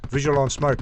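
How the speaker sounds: ordinary delivery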